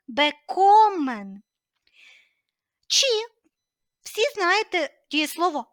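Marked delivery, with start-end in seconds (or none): 0.1-1.4 «бекомен» with a long, louder КО after an unstressed бе; stress on second syllable. Shown as a demonstration. Teacher shows where stress falls after prefix, «бекомен» stressed on КО. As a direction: lean slowly on the КО in «бекомен». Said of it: "Наголос падає на другий склад після префікса".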